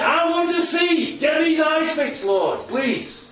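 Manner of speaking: high, drawn-out plea easing at the end; blind man's plea. Acted. Blind man praying for his eyes, high insistent plea. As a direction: beg boldly, then settle on "please". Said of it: "he says".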